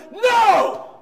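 loud, high screamed no; furious shouted no. Shown as a demonstration. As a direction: scream it at full force. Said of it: "I'm not saying scream no in her face".

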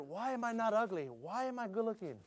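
put-on joking kid wondering aloud; mock kids' musing. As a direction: muse like a puzzled teen. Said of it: "if my kids ever wondered".

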